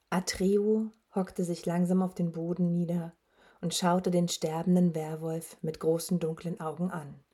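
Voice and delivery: usual speaking voice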